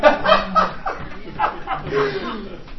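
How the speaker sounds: high, loud laughter; elated laugh. Real, not acted. Elated after good news.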